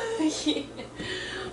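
soft, nervous laugh after the shock; breathless laugh. Real, not acted. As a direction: laugh off the scare.

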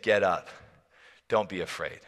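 low, soft reassurance; calm frightened friends quietly; hushed, tender comfort; gentle Jesus. Acted. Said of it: "Jesus came and touched them".

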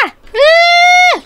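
high, loud scream from afar; shrieking out in the water. Acted. Girl screaming out at sea to trick her sister, scream. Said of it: "ela tá só gritando".

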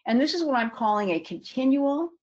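ordinary talk

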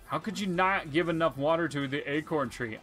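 ordinary delivery

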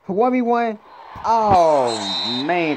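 high, loud call sinking into dismay; real excited cry turning to disappointment; loud play reaction. Real, not acted. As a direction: shout the matchup, then groan loudly. Calling a play, then disappointed.

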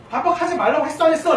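high, loud scolding; annoyed dad. Acted. Father telling off his child, loud and irritated. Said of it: "라고 짜증냈을 때".

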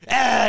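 short, high startling "Ah!"; imitated jump-scare shout; jump-scare yell. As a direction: burst out with a sudden yell.

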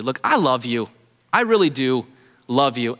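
usual speaking voice

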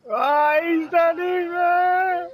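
high, loud burst of excitement; excited yell. Real, not acted. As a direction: shriek with delight. Excited reaction to the race.

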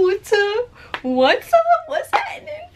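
squeaky playful hello; high squeaky greeting. Pretend. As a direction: chirp it like a cartoon.

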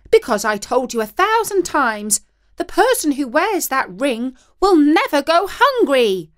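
angry wife. A narrator's reading. Wife shouting at husband.

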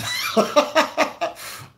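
a sudden high laugh at the question; high burst of laughter. Real, not acted. Igbo-language vlogger laughs at the question about the most used dialect: a high laugh.

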